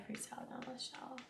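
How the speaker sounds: under-the-breath reading of the list; whispered, half to herself. Real, not acted. Streamer reads the cart lady's stock to herself, whispered.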